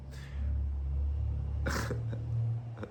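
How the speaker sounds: whispered wheezing laugh; breathy laugh. Real, not acted. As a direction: laugh silently through the breath. Laughing at own bad accent.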